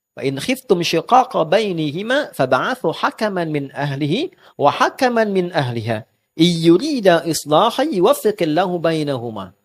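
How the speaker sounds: slow, measured Arabic recitation; Quran recitation. Acted. Preacher citing the Quran on marital disputes, reciting slowly. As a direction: recite the verse with drawn-out syllables.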